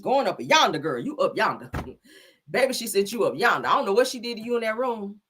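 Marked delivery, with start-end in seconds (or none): none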